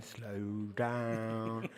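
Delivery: long drawn-out self-correction; slowing right down. Real, not acted. Speaker telling themself off for talking fast, drawn-out slow. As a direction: stretch both words out slowly, in comic self-reproof. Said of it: "I'm talking fast again".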